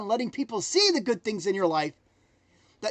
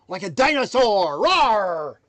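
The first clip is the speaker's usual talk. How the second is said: dinosaur roar; 2 semitones lower, 7 dB louder, slower than usual. Pretend. Motivational dinosaur roar chant.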